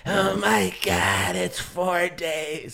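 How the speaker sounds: put-on slow, deep raspy old lady; drawn-out gravelly old woman; deep old smoker voice. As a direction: drag it out like an eighty-year-old smoker. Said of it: "That hurts my throat going that deep".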